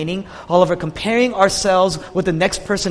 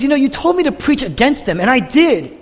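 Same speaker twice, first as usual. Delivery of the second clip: high, louder plea of indignation; confused protest; 7 semitones higher, 4 dB louder than usual. Retold or imitated. Preacher as Jonah protesting to God, confused. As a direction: protest upward as a baffled prophet. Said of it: "he's confused".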